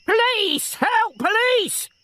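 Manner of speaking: high piercing scream for the police; screaming for help. Acted. Old woman attacked by a burglar, screaming for police. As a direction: shriek it as if attacked.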